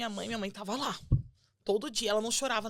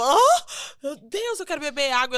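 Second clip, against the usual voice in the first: desperate plea; 5 semitones higher, 10 dB louder than usual. Retold or imitated. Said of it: "Eu ficava".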